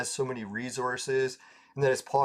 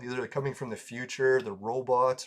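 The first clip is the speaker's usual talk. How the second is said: ordinary talk, nothing marked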